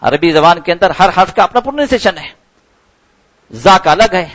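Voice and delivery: ordinary talk, nothing marked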